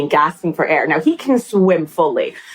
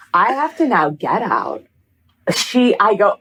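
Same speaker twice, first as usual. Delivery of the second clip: usual speaking voice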